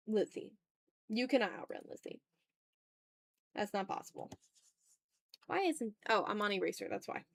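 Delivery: ordinary talk, nothing marked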